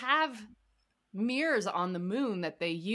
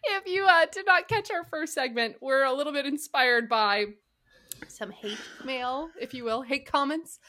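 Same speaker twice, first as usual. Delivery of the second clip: ordinary talk, nothing marked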